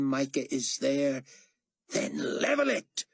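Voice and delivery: seething commands; cold fury. A narrator's reading. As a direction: order the killing. Carpathia orders slaughter.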